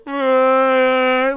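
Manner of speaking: long, high, loud fake bawl; hammed-up sustained wail; mock crying wail. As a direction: imitate a kid bursting into tears. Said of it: "this kid just starts crying".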